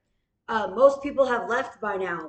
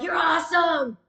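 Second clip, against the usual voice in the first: strangers cheering "You're awesome", higher and shouted; shouted praise; 6 semitones higher than usual. Retold or imitated. Strangers calling out praise to him, shouted. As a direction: call it out like an excited stranger. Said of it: "they were like".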